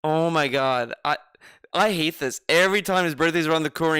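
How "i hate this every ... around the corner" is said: ordinary talk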